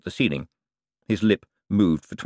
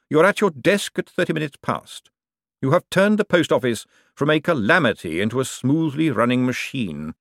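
measured, unhurried, lightly voiced lord; the Patrician praising Moist, lightly voiced and cool; lightly voiced calm tyrant; 5 semitones higher, 3 dB louder than usual. A narrator's reading. Vetinari voiced in audiobook.

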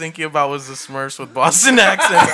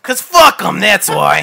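put-on Boston accent; 3 semitones higher, 8 dB louder than usual. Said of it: "Should we do it all in a Boston accent".

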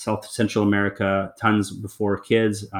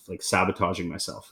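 usual speaking voice

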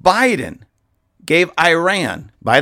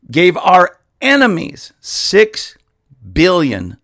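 ordinary talk, nothing marked

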